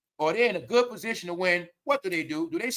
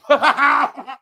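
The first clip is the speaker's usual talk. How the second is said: mocking laugh; 7 semitones higher, 7 dB louder than usual. Real, not acted.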